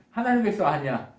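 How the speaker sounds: ordinary delivery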